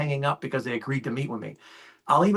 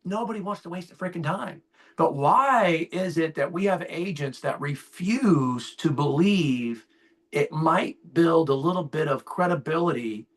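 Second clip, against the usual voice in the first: ordinary delivery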